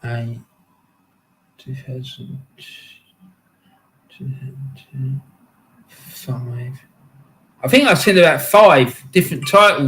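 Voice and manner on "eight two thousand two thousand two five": counting to himself. Real, not acted. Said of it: "let me count how many I've seen".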